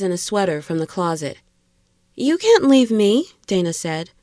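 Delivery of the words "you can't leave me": high, loud woman's plea; desperate, raised female character voice; distressed protest. A narrator's reading. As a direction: cry it out, pitch jumping up. Dana protests being left alone.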